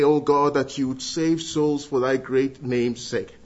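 ordinary talk

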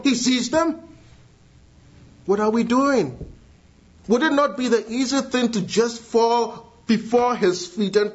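voicing Hagar's prayer from the pulpit; fervent preaching. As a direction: preach it with urgency.

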